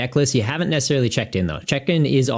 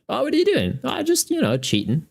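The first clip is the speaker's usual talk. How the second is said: much higher mock-innocent voice; mock dialogue. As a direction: play both sides of a cheeky exchange. Imagined chat about cheating.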